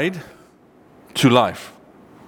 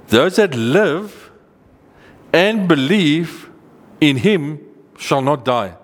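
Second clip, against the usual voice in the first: "live" and "believe" drawn out slowly and pitched high; slow, raised stress on "live" and "and believe", then settling on "die"; emphatic paraphrase; 4 semitones higher, slower than usual. Shown as a demonstration. Preacher explaining the verse to listeners, slow and emphatic.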